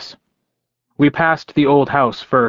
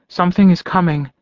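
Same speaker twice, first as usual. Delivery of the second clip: higher, ominous warning; uneasy warning; 6 semitones higher than usual. Acted. Elizabeth warning on phone.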